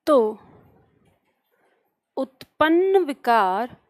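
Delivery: slow dictation with long pauses between phrases. Acted. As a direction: dictate word by word for note-takers, drawing out each sound. Dictates definition to class. (धीमे-धीमे, रुक-रुक कर श्रुतलेख)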